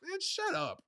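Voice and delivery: mock kid shouting at the furnace; kid telling furnace off. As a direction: snap at the monster in a high kid voice. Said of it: "the first he's like".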